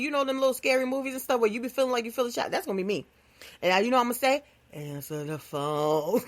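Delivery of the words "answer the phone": low slow spooky ghost; ghostly voice. Pretend. [name] as a ghost haunting someone who ignored her, slow spooky.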